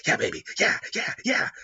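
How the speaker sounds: put-on loud gleeful yeah baby; loud hype. Man cheering himself on loudly, gleeful.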